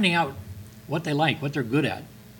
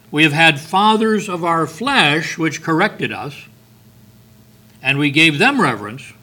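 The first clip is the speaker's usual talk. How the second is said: forceful scripture reading; 3 semitones higher, 5 dB louder than usual. Acted. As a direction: thunder the verse at the congregation.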